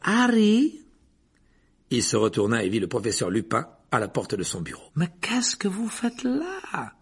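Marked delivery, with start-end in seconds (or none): 0.0-0.8 high, loud questioning call. A narrator's reading. Professor calls Harry from his office doorway, calling out. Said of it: "lorsqu'une voix l'appela". 5.0-6.9 high, slow, unthreatening question; friendly professor. A narrator's reading. Professor finds Harry alone, with none of the caretaker's suspicion. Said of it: "d'un ton très différent de celui de Rusard".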